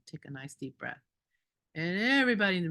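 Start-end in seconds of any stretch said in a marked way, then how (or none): 0.1-1.0 softer, low and calming; quiet reassuring coax; soothing murmur. Retold or imitated. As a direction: ease her down gently with a hushed voice. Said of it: "and I just said".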